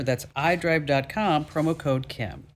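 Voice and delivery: slow, deliberate restating of the address; careful slow promo recap; slow repeat. Acted. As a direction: spell it out slowly so listeners catch it. Repeats the web address slowly.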